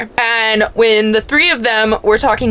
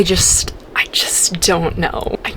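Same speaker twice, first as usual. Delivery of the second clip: soft, slow, overwhelmed whisper; 5 semitones lower, 5 dB quieter than usual. Real, not acted. Reader overwhelmed by the story, whispering. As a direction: let it fall to a breath. Overwhelmed by emotions over book.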